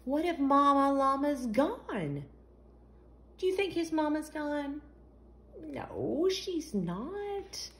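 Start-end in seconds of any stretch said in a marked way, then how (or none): none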